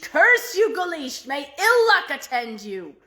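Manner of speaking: loud, high angry curse; furious yelling. A narrator's reading. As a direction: hurl it at him like a spell. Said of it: "yelled at Guleesh".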